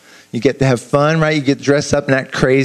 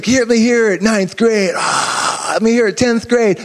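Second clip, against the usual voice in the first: cheerleader call; 7 semitones higher than usual. Pretend. Cheerleader calling to grades.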